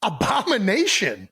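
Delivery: high, drawn-out disbelief; mock-scandalized question; incredulous echo. Real, not acted. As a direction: repeat it slowly as if shocked by the word. Echoing a strong word in disbelief.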